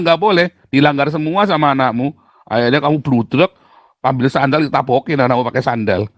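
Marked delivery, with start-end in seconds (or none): none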